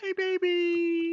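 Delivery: very high, drawn-out coo. Real, not acted. Greeting a baby on the call, high cooing baby talk. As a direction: squeal a long sweet hello.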